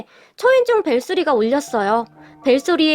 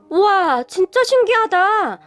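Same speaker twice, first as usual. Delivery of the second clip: higher, wide-eyed little-sister wonder; amazed; 6 semitones higher, slower than usual. A narrator's reading. Younger sister marvelling that the stranger looks like her brother. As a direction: marvel at the lookalike. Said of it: "라고 감탄하며 말을 했어요".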